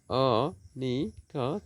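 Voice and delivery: "Anika" very slowly as অ, নি, কা; three stretched beats with gaps in "Anika"; syllable by syllable. Shown as a demonstration. Teacher dividing a name for students, "Anika" slowly in three beats. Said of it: "তিনটি syllable".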